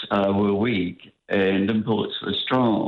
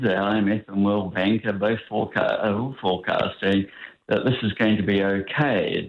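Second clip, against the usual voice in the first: normal talking voice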